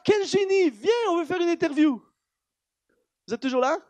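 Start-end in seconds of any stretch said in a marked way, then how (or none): 0.0-2.0 mock-excited, high-pitched invitation; excited admirer. Interviewers now calling him a genius, excited. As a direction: gush like a newly won-over fan. Acted admirers after earlier mockers.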